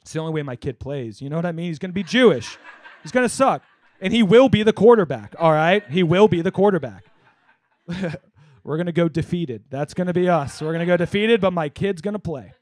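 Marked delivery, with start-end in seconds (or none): 3.0-7.0 emphatic insistence. Real, not acted. Insisting his kid plays.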